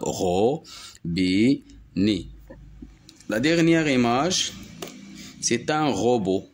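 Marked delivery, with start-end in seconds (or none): 0.0-1.6 "robinet" slowly split into ro, bi, net; syllable by syllable. Shown as a demonstration. Teacher demonstrating three syllables, syllable by syllable. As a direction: give "robinet" three separate beats. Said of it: "trois syllabes".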